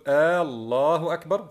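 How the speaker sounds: الله opened with a long "aa": "aallahu akbar"; hamza before Allah. Retold or imitated. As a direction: drag the first vowel of الله. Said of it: "وضع همزة في بداية لفظ الجلالة". (مدّ الهمزة في أول الله: آلله أكبر)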